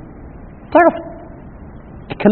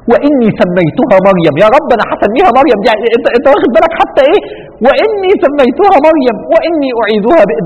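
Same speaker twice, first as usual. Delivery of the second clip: fervent plea; 9 semitones higher, 4 dB louder than usual. Retold or imitated. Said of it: "قالت".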